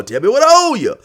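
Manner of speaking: loud, high voice, pushing to pay up; a high, loud, insistent buyer; loud put-on voice.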